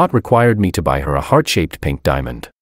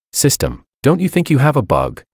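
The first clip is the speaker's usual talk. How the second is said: helpless; 7 semitones higher than usual. A narrator's reading. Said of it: "I asked helplessly".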